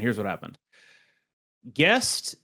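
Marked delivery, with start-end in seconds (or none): 0.0-0.6 softer, as if letting you in on a secret; dropped to a hush. Shown as a demonstration. Co-host about to explain the missed week, hushed. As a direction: repeat it much softer, a conspiratorial aside. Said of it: "H-, here's what happened".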